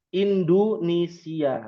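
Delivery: slow indunisia with u vowels; slow Arabic pronunciation. Shown as a demonstration. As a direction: slowly model Indonesia as indunisia.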